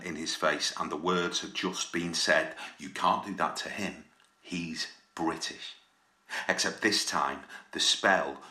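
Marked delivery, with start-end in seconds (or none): 2.8-5.8 hushed. A narrator's reading. Narrator lowering voice on the magic words. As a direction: let it drop softly as a spell.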